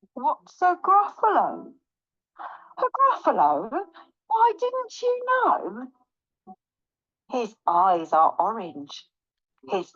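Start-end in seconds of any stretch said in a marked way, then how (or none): 0.1-1.7 high, curious question; puzzled snake. Acted. Snake puzzled by unknown creature. 2.8-5.9 mock astonishment. Mouse feigning surprise at Snake.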